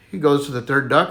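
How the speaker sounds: normal talking voice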